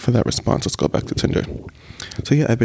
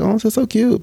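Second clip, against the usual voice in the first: high, gushing voice; 10 semitones higher than usual. Retold or imitated. Vlogger acting out her smitten side, high gushing voice. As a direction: squeal it like you're smitten. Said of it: "This is my thought process".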